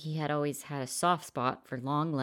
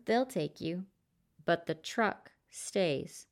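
calm stranger; 7 semitones higher than usual. A narrator's reading. Stranger calmly lays down terms.

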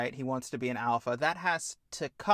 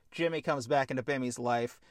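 ordinary talk

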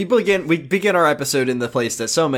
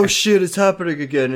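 mock alarm; 5 semitones higher than usual. Voices Kyle's alarm.

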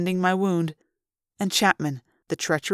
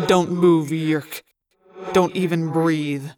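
low threat; 2 semitones lower, slower than usual. A narrator's reading. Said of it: "Alaran said".

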